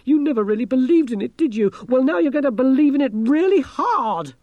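high, loud, frantic scolding; frantic reproach. A narrator's reading. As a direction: berate a doubter in panic. Panicked berating under falling ship.

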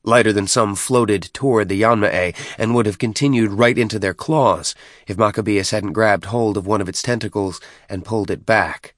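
usual speaking voice

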